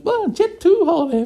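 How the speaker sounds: a sister teasing with the cheesy ad line; imitating the Jet2 advert. Brooke winding him up with the Jet2 trend, mock advert voice. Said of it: "she kept saying".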